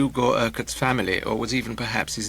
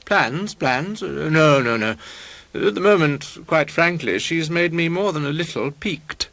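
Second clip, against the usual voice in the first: higher, lightly voiced pompous bluster; pompous painter, piqued; 4 semitones higher than usual. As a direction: brush the question off grandly. Bertrand blusters about his plans.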